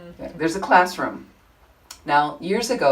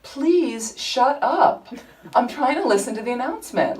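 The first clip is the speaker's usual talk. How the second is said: firm request; 6 semitones higher than usual. Retold or imitated. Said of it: "I decided I need to be stronger".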